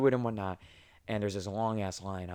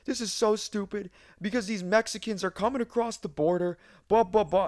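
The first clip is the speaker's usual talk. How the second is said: much higher mock rant, a different voice; flipping out; 10 semitones higher than usual. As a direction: rant like a furious customer losing it. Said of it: "he fucking flips out".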